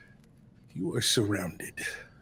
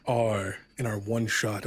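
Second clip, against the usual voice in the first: ordinary talk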